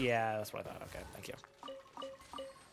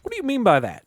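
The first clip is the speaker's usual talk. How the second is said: loud indignant question; 8 semitones higher, 10 dB louder, faster than usual. Acted. Acting reply to Freminet.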